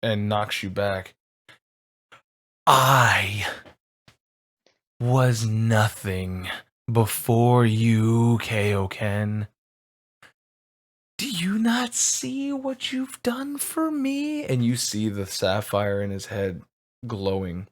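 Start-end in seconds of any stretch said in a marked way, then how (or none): 2.7-9.5 slow, heavy, menacing; villain thanking Kaioken with menace; villain speech. Acted. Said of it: "villain speech". 11.2-14.4 much higher, slow, unhinged; villain revelling in his power; villain exaltation. Acted. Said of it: "villain speech".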